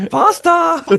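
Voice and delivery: very high, squeaky imitation of a cartoon German guy; German guy impression. Host imitating a South Park German guy, squeaky and high. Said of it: "the fucking German guy's like".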